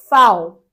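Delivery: fal, said loud like an F sound; German letter V. Shown as a demonstration. Teacher showing how Germans name the letter V. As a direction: say fal, V spoken as F. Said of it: "fal quando quiser falar a letra V".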